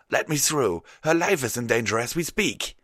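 high, loud cry of alarm; urgent plea. A narrator's reading. Unicorn warning guards of danger to princess, high urgent cry. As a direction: plead loudly at a high pitch. Restrained unicorn pleads to get through.

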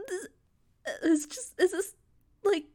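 ordinary talk

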